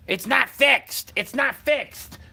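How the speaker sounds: high, slow, loud mocking whine; mocking screamed whine. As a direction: mimic them shrieking about the Netflix being broken. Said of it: "you screaming about fucking Netflix".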